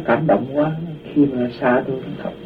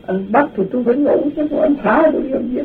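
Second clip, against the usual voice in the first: cheeky retort; 9 semitones higher than usual. Retold or imitated. Retorting to police, half-joking.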